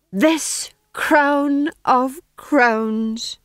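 high, loud, slow declamation; soaring stage speech. A narrator's reading. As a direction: build to a triumphant proclamation. Tom John's mercy speech.

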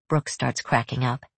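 ordinary delivery

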